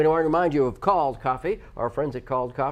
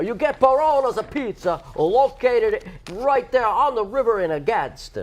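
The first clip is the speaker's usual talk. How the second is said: much higher, louder, slow put-on Italian pizza man accent; 11 semitones higher, 5 dB louder, much slower than usual. Host announcing the first coupon, hammed-up Italian accent. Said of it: "which means an accent".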